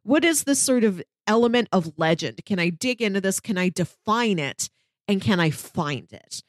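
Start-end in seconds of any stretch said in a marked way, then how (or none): none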